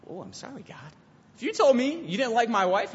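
ordinary talk

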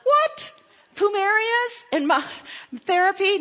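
very high, squeaky incredulity; astonished disbelief. Retold or imitated. Jogger reading an unlikely sign, astonished disbelief. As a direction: shoot the pitch way up in mock amazement.

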